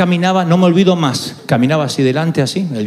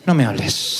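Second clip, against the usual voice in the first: low, icy, softer shutdown; cold mother; 9 semitones lower, 3 dB quieter than usual. Retold or imitated. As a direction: freeze him out, cut him off. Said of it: "me aplicó la frialdad".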